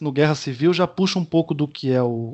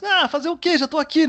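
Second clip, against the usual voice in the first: much higher, hammed-up shrugging acceptance; forced resigned tone; 13 semitones higher than usual. Accepting another helping of sugarcane juice, high hammed-up resigned voice. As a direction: push the voice way up, mock resignation. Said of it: "dá uma entonação na voz".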